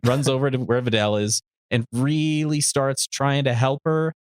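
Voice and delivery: ordinary talk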